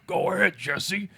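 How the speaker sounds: a hint of Freddy coaxing Jesse; Freddy taunting. Freddy coaxing Jesse to take the glove, lightly taunting. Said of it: "He's like".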